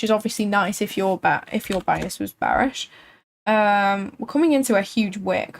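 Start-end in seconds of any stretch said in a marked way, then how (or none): none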